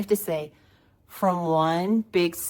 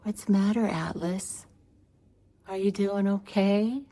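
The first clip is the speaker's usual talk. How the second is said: put-on higher-pitched, sweetly concerned little girl act; imitating innocent child; 5 semitones higher than usual. Niece innocently checking on her upset brother, mock-innocent child voice. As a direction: play a mock-innocent big sister. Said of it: "She would have that big, innocent look on".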